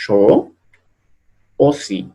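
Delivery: "yo, o sí" as French shoh, oh-see; pure oh for au in "yo, o sí". Shown as a demonstration. Teacher giving French examples, AU as oh. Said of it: "que se va a pronunciar O".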